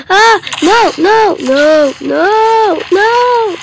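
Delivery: high, loud shrieked no's; terrified screams. Real, not acted. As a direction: scream in terror. Attacked again.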